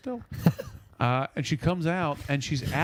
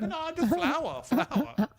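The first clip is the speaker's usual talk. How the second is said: put-on very high, drawn-out old lady voice; imitated old lady; 14 semitones higher, slower than usual. Old lady explaining the dirt to a neighbor, high-pitched mimicry.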